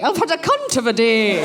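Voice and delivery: prim, posh Scottish schoolmistress; genteel Scots teacher voice; Miss Jean Brodie. Shown as a demonstration. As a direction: deliver it in a refined Scottish accent. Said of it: "kind of my Miss Jean Brodie".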